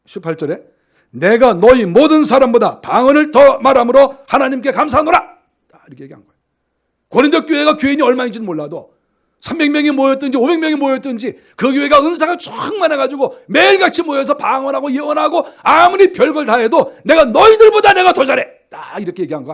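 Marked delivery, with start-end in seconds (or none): 1.1-5.4 loud ringing proclamation of the verse; proclaimed verse. Acted. Preacher declaring Paul's words to the congregation, loud and ringing. 7.1-17.0 loud, driving sermon delivery; fervent preaching. Acted. Pastor preaching to his congregation, loud and fervent. 17.0-18.5 higher, loud bragging cry; boastful shout. Acted. Paul boasting to the Corinthian church, loud and higher.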